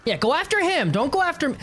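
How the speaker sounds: usual speaking voice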